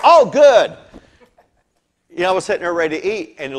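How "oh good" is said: high, loud, bursting hello; loud excited greeting. Retold or imitated. Leon Fontaine coming on TV, loud and excited. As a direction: burst in like a TV host. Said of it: "he came on and went".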